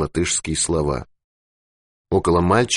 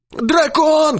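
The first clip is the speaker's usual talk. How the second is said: frantic high shout; screaming, much higher and louder; 4 dB louder than usual. A narrator's reading. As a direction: yell for the grenade in panic. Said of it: "кричал Дауги".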